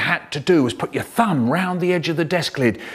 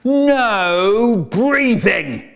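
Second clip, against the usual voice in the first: slow loud drawn-out command; teacher ordering the class not to breathe; stern teacher; 8 semitones higher, 5 dB louder, much slower than usual. Retold or imitated.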